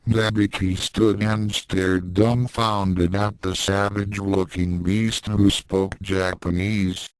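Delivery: ordinary delivery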